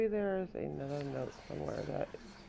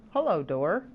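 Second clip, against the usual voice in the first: playful greeting; 3 semitones higher, 12 dB louder than usual. Real, not acted. Greets door playfully, loud.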